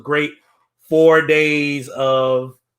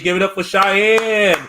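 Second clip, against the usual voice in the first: announcer hype; 6 semitones higher, 3 dB louder, slower than usual. Acted. Host hypes the performer.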